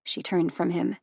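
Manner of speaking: ordinary delivery, nothing marked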